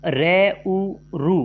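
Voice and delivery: रे and उ stretched slowly, then joined into रु; teacher slowly blending रे plus उ into रु; slow sound blending. Shown as a demonstration. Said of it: "इसकी व्याख्या देखें".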